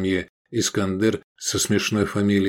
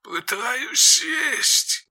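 high, slow creaky strained voice; creaky headset voice; much slower than usual. A narrator's reading. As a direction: crackle out over the headset. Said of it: "проскрипело в наушниках".

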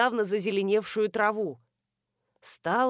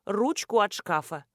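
plain reading voice

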